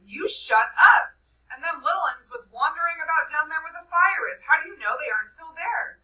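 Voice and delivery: loud, high, angry retort; Jack snapping. Acted. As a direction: Jack turns on Piggy. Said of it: "Jack turned on him".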